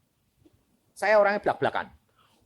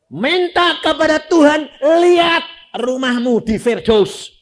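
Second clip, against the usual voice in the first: higher, louder encouragement; eager urging; 10 semitones higher, 8 dB louder than usual. Retold or imitated. Son urging his mother to ask God, loud and eager. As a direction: urge her on, bright and insistent.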